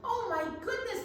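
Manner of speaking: high gasping wonder; delighted surprise; surprised. Real, not acted. Reacts to pig picture.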